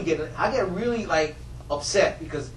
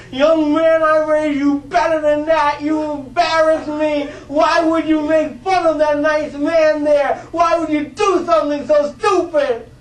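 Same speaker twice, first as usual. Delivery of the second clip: much higher, louder, slow mock yelling of an angry mom; scolding mother; 9 semitones higher, 7 dB louder, slower than usual. A mother yells at her son for mocking a man, loud and slow. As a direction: scold him like a furious mother. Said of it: "she started yelling at him".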